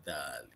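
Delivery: "Dali" with a voiced th at the start; dental dh. Shown as a demonstration. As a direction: say "Dali" with tongue between the teeth. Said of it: "tongue between your teeth".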